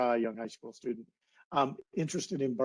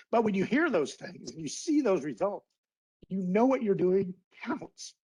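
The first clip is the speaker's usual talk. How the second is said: a little choked up, pitched higher, holding it together; getting emotional; 5 semitones higher than usual. Real, not acted. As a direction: let the feeling creep into the voice as you speak. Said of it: "I get a little emotional".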